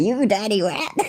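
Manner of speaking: hammed-up old American gangster accent; mock American gangster. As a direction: imitate an American gangster, badly. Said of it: "That's what I based it on".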